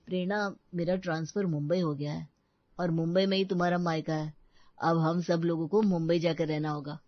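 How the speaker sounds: a hint of a calm husband breaking news; husband's voice. Narrator voices husband Krish. (पति की हल्की-सी अलग आवाज़, शांत ढंग से)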